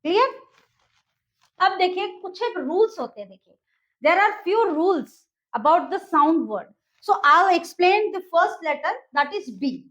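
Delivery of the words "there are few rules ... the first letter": slow explaining. Shown as a demonstration. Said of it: "switches to slow English explanation".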